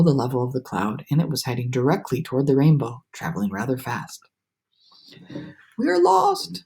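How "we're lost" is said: much higher, panicked; despairing cry. A narrator's reading. Miss Spider panicking at the crash, high despairing cry. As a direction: cry out in dread. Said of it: "Miss Spider cried".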